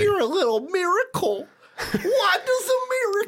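very high-pitched mock imitation; mocking squeaky voice. Podcaster mocking a disliked movie line to co-host, squeaky falsetto. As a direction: put on a silly falsetto, breaking into a laugh.

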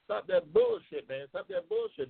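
ordinary delivery

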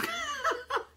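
very high squeal of laughter; real high giggle; shrill laugh. Real, not acted. Laugh alone on line.

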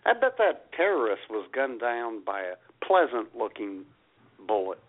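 ordinary talk

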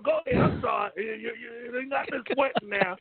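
ordinary talk

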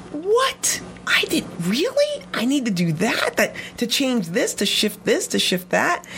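high, astonished questioning; retold incredulity. Pupil reacting to a running coach's corrections, high incredulity.

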